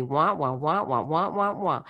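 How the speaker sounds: muffled trombone wah wah; tuned-out wah-wah drone; Charlie Brown adult voice. Retold or imitated. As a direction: mimic the teacher's droning wah wah. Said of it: "it's like Charlie Brown movie".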